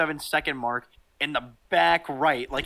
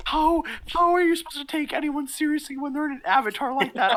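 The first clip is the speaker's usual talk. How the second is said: incredulous; 12 semitones higher than usual. Retold or imitated. Reacting to a couple's silly avatar, incredulous. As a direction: pitch it way up, baffled and protesting.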